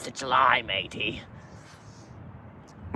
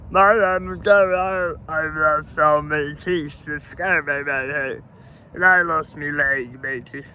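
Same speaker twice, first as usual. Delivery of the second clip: higher, louder goofy pirate voice; mocking Gary Gums; 3 semitones higher, 4 dB louder than usual. Mocking a toothless pirate he calls a fool. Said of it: "Petey Pegleg and Gary Gums, the pirate duo".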